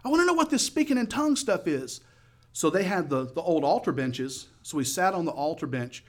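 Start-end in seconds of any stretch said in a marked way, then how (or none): 0.0-2.0 high, raised voice over music; yelling over noise. Retold or imitated. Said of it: "I'm having to yell".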